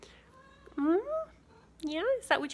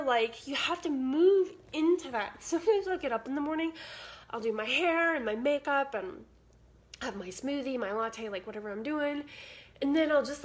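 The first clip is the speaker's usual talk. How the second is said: normal talking voice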